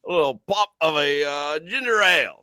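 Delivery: the pleased English gent; lightly done posh Brit; mock British accent. Host answers in mock British.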